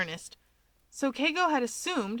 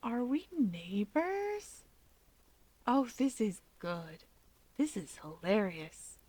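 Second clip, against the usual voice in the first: soft, slow, delighted; sick, gleeful brother; 6 dB quieter, slower than usual. A narrator's reading. Feverish teen delighted to find Hawks is his neighbor, soft and slow. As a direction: savour the joke through the fever.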